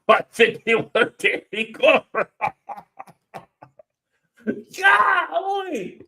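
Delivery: laughing high through the punchline; cracking up at a friend's joke. Real, not acted. Laughing loudly at a chat joke about Danny Glover.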